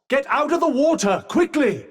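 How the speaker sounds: high, urgent command; urgent warning. Acted. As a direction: hurry them out of danger.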